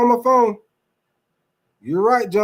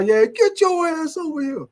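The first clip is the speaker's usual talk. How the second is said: high mock coach call; imitating a coach calling a player over; 6 semitones higher than usual. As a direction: summon a player off the field like a coach. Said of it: "your coach be done call".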